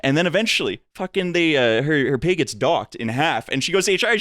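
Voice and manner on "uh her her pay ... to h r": ordinary delivery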